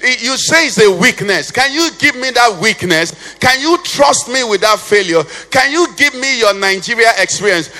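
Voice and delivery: preaching at full force. Acted. Preacher pleading with the congregation, full force. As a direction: preach it out to the whole church. Pastor voicing Jesus' appeals.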